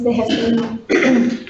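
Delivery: hoarse, strained voice giving out; losing voice. Real, not acted. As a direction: voice cracking and failing. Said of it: "sorry, I'm losing my voice".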